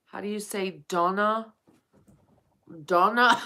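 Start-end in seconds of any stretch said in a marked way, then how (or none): 2.8-3.4 American accent. Shown as a demonstration. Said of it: "In your American accent?".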